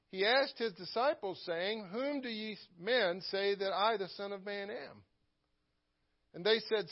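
measured, lifted King James reading; scripture reading. Acted. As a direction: read the verses with weight and reverence. Reads scripture aloud in sermon.

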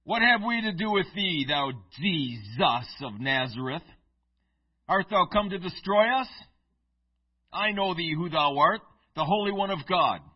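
loud snarling demonic voice; guttural demon. Acted. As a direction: roar it as the possessed man's demon. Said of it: "That's my best guttural voice".

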